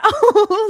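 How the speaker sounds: high, loud emotional laugh; teary laugh. Real, not acted. Podcaster laughs while tearing up over hockey. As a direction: burst of laughter through tears. Tearful laugh after admitting tears.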